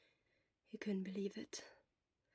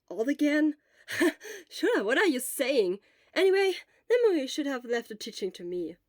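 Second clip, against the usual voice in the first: Hisashi's voice; 5 semitones higher, 8 dB louder than usual. Acted. Hisashi teasing Shota.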